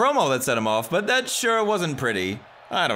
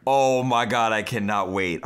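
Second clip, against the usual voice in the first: ordinary delivery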